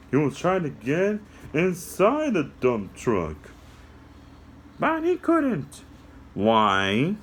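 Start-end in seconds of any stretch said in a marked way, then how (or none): 4.8-5.8 high squeaky puppet, slow; a little puppet explaining to kids; high cartoon helper voice. Puppet answers.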